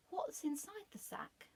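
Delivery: curious lady peeking at the sack; high curious lady. Acted. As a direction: ask it like a timid young woman. Scared lady asks fox.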